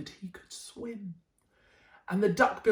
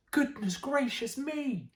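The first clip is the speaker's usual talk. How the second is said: higher, slow goodness gracious; shocked exclaim; 7 semitones higher than usual. Acted. Mother seeing her reflection, higher and astonished. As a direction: gasp it out in disbelief.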